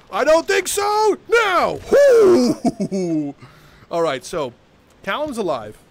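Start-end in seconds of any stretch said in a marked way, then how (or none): 0.1-1.8 very high, loud drawn-out yell; battle shout. Acted. Shouting while attacking opponent in game. 1.8-3.5 high, loud "hoo-hoo" laugh; whooping laugh. Real, not acted. Gleeful after crushing opponent.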